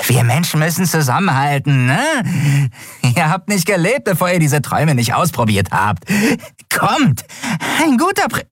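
high voice climbing as he begs; pushy, pleading dealer. A narrator's reading. Dealer presses two strangers to buy, high and pleading.

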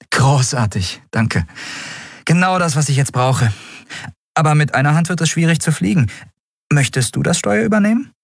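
grateful, then tease him with the wheel; pleased captain. Acted. Captain thanks Miko and offers him the helm, pleased.

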